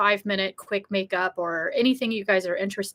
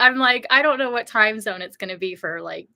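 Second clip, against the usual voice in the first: ordinary talk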